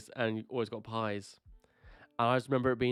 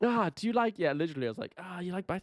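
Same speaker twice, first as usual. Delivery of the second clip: awkward date chatter; 8 semitones higher than usual. Retold or imitated. Reenacting an awkward date's small talk.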